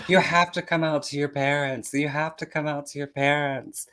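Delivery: high insistent chorus of friends; mock high-pitched pleading; pushy friends. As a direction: nag him like excited girlfriends. Friends pushing him to come out.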